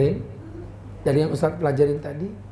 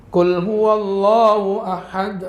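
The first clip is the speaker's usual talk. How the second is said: slow, louder chanted verse; Quran recitation; 2 semitones higher, 4 dB louder, much slower than usual. Shown as a demonstration. Ustadz reciting the verse before translating it. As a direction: recite it with tajwid, drawn out. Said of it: "recites verse as example".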